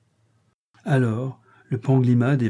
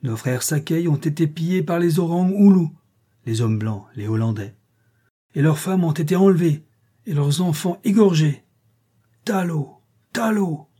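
impassioned chief's address, higher pitch; chieftain orator; 6 semitones higher than usual. A narrator's reading. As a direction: stir the crowd with rising indignation. Chief's speech to crowd.

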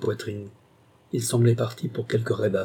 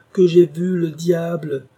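much higher, louder and slow, "j'ai" hit hard; furious shout; 6 semitones higher, 7 dB louder, slower than usual. A narrator's reading. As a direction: drag out the repeat as a roar. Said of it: "sa colère se rua encore sur Alan".

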